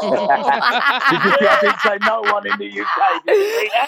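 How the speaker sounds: slow, amused and very high on the last question; chuffed at dodging a UK club, laughing; laughing, pleased. Real, not acted. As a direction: crow about your own smart answer. Pleased with his clever answer.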